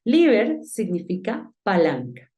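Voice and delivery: ordinary talk, nothing marked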